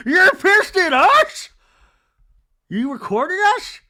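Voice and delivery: slow, high-pitched screaming outrage, hammed up; Meredith. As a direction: shriek it mockingly, dragging out every word. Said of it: "Meredith stands up and just gets into the camera angle".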